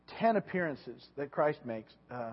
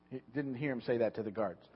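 normal talking voice

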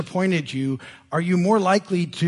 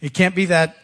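ordinary delivery

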